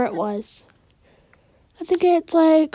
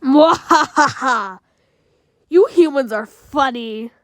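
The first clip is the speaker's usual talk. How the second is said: sneering panto villain; 3 dB louder, slower than usual. Acted. Monster appears, villain taunts.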